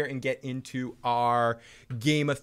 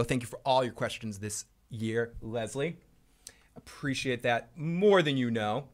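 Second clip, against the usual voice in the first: ordinary talk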